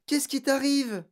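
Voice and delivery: high-pitched worried question; startled and concerned; alarmed concern. A narrator's reading. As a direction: ask the child urgently what is wrong. Teacher alarmed, asks breathless child.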